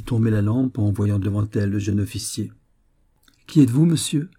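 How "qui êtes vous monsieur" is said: startled, higher-pitched cry, mildly acted; troubled exclamation. A narrator's reading. Young woman finds an officer in her room, troubled exclamation. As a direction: exclaim it in alarm, voice lifted. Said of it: "s'écria-t-elle d'une voix altérée".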